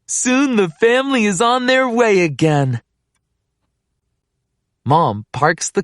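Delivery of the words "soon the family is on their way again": ordinary delivery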